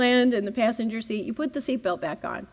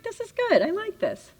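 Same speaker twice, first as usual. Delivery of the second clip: high, sing-song pleased voice; dreamy contentment; 10 semitones higher than usual. Retold or imitated. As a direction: coo it like everything is perfect. Relaxed driver, blissed out.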